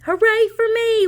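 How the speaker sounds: high loud cheer; gleeful shout. Acted. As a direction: crow over the win. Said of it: "Wing shouted".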